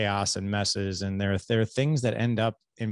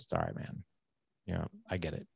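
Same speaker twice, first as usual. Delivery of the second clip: son's soft forgiveness; 3 semitones lower, 10 dB quieter, faster than usual. Retold or imitated. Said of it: "He goes".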